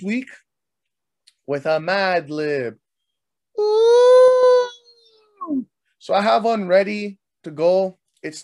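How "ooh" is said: long, high, loud "ooh"; high hoot. Real, not acted. Host announcing the Mad Lib segment, playful high whoop.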